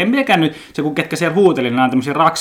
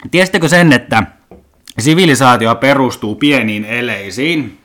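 usual speaking voice

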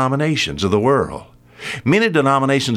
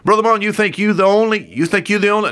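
quick, higher taunting challenge; retelling a scoffer who mocks the preacher; mocking skeptic; 9 semitones higher, faster than usual. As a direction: needle him like a doubting neighbour. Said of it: "I've had people tell me".

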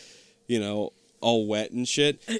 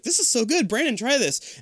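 excited; 11 semitones higher than usual. Retold or imitated. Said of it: "he took a drink and was like".

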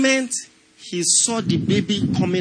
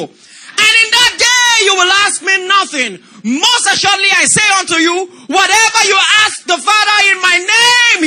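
man and woman together; 9 semitones higher, 9 dB louder than usual. Real, not acted. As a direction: read it aloud with the whole congregation. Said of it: "Everybody read verse twenty-three together".

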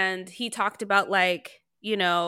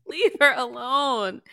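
laughing plea; 5 semitones higher than usual. Real, not acted. Laughing at the camera close-up.

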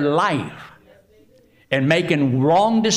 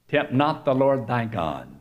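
usual speaking voice

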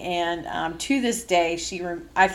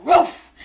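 a loud bark, louder and higher; mock bark; 7 semitones higher, 9 dB louder than usual. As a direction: bark the word like a dog. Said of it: "I barked at her".

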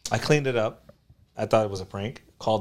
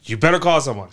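stern dad warning; 4 semitones higher, 11 dB louder than usual. Retold or imitated.

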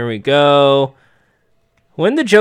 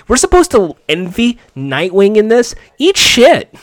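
high, loud, outraged tirade; furious rant; 8 semitones higher, 5 dB louder than usual. Real, not acted. Fan furious that the hero hurt the puppy, shouted rant. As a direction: rage at the show at top pitch.

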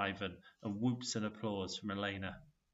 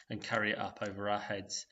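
plain reading voice

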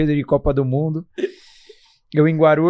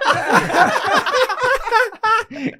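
high laughter. Real, not acted. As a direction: burst of high-pitched laughter. Laughing at his own story. (gargalhada aguda)

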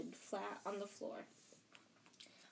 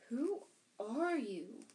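slow, drawn-out, wondering question; puzzled boy's question; 4 semitones higher, 3 dB louder, much slower than usual. A narrator's reading. Harry asks the giant who he is, slow bewildered question. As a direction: stretch each word out in mild bewilderment.

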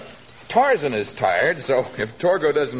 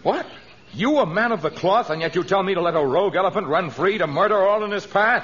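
indignant disbelief; 8 semitones higher than usual. Acted. Tarzan shocked at missionary's request.

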